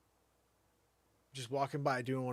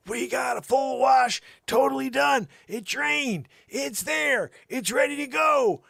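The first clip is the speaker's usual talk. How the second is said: much higher, louder, slow; loud high proud announcement; excited shout; 14 dB louder, slower than usual. Retold or imitated. Excited about finished wash.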